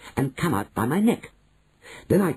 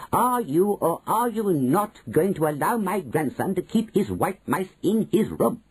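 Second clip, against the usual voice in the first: higher, demanding; grandmother demanding; 8 semitones higher than usual. A narrator's reading. Grandmother to manager, a higher demanding voice. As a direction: press for a yes, pitched up.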